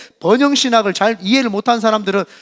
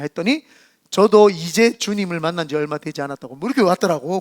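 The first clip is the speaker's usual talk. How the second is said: ordinary delivery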